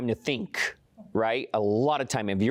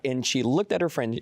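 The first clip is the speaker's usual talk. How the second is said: normal talking voice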